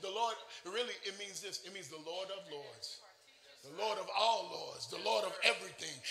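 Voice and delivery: ordinary talk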